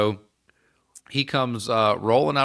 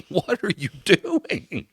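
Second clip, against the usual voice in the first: incredulous; 8 semitones higher, slower than usual. Retold or imitated. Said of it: "one of our other guys was like".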